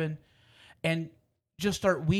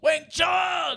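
much higher, louder crowd cheer; stadium shout; 11 semitones higher, 4 dB louder than usual. Retold or imitated. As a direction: yell it across the ballpark.

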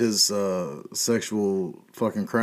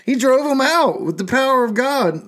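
mock preacher; 12 semitones higher, 3 dB louder than usual. Mock believer answering doubter.

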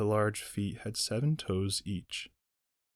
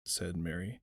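usual speaking voice

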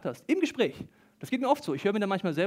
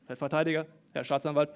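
usual speaking voice

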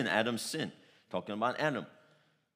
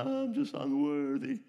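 higher mock-pitiful whine, put-on voice; groveling unworthiness; 6 semitones higher than usual. Preacher mocking a groveling believer's prayer, pitiful high whine. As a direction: play the cringing beggar at God's feet. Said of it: "Not crawling, looking down".

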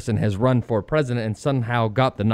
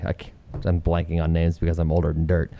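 ordinary delivery

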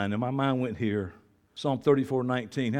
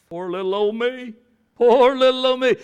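mock self-pity; 9 semitones higher, 8 dB louder than usual. Preacher mimicking self-pitying believers, mock whine. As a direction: whine it, getting louder on the repeat. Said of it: "And we don't say it, but we say it".

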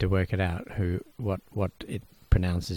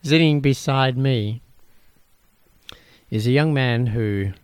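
grand announcement; 5 semitones higher, 6 dB louder than usual. Acted. Redoing the intro more grandly.

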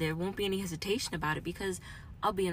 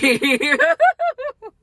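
very high, loud burst of laughter; loud shrieking laugh; 15 dB louder than usual. Real, not acted. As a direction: let the anger explode into laughter. Laughs after admitting anger.

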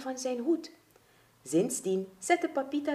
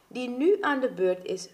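ordinary delivery, nothing marked

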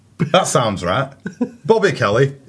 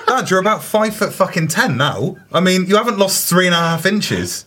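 exasperated son; 6 semitones higher than usual. Retold or imitated. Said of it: "It's like".

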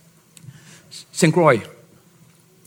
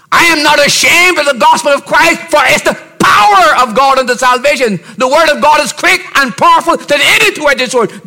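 higher, louder sermon delivery; preaching at full force; 9 semitones higher, 4 dB louder than usual. Acted. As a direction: preach it out to the congregation. Preacher quoting scripture.

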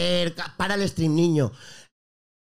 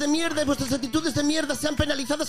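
higher-pitched mock streamer rant with a Galician accent; Chocas impression; 4 semitones higher than usual. Streamer mocking his Twitter critics, higher-pitched parody rant. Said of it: "esto es el discurso del Chocas".

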